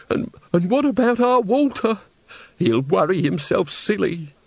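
put-on high, stammering worried old woman; anxious old woman. A narrator's reading. Mother worries about her son Walter, anxious. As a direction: fret in a high voice, drawing out the end.

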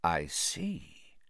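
low, hushed, cold reply; quiet icy master; soft breath. A narrator's reading. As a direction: let it out softly, ominously. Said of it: "breathed the second voice softly".

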